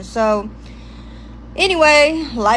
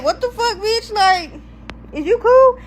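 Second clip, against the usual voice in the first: high, stunned what-the-fuck; high-pitched retold shock; shocked disbelief; 9 semitones higher than usual. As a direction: squeal it out in shock. Shocked at positive pregnancy test.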